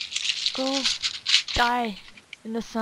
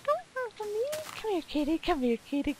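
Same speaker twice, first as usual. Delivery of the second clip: coaxing a cat; 12 semitones higher than usual. Real, not acted. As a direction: sweet-talk the kitty closer.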